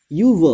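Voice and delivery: teacher modelling यू व with silent r; correct pronunciation. Shown as a demonstration. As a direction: stress यू व clearly, dropping the r. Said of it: "आप जब बोलेंगे ना यू व".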